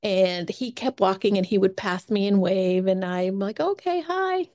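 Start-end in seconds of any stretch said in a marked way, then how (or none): 3.6-4.5 bright high-pitched friendly greeting. Retold or imitated. Greeting a passing hiker with a high cheery hi. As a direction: chirp a cheery hello way up high. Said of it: "I'm friendly as always".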